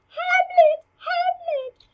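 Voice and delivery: very high, loud mock cry; calling out Hamlet's name. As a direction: call out in a squeaky-high pretend alarm.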